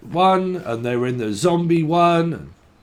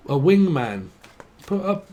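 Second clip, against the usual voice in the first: ordinary talk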